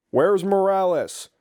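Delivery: slow drill sergeant demand. Retold or imitated. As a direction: drag out the question, annoyed.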